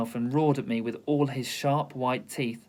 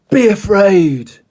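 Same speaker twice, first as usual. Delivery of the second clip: slow, booming, very loud lion voice; storybook lion growling threat; lion roar; 6 semitones higher, 15 dB louder, slower than usual. Acted. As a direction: roar it like a menacing beast. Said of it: "he roared".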